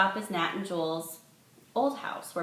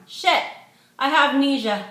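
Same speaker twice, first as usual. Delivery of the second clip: high, loud tipsy alarm; drunk outburst; 9 semitones higher, 10 dB louder than usual. A narrator's reading. Drunk bride-to-be realizes she forgot, drunk outburst. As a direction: blurt it out, drunkenly dramatic.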